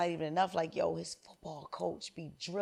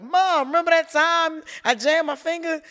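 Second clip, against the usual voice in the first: much higher, louder mocking kid voice; imitating grown-up son; 10 semitones higher, 14 dB louder than usual. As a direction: accuse mom like a wounded teen. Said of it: "even my son will get me canceled".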